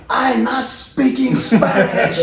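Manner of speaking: slow, high, loud mock yell; hammed-up accented shout, drawn out; exasperated protest. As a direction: imitate a gentle man pushed to shouting. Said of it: "sweet, gentle man".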